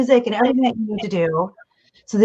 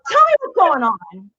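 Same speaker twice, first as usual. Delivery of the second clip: higher, urgent, agitated demand; worked-up matching; 5 semitones higher than usual. Acted. Parent matching a kid's fit, urgent and high. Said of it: "is much different than".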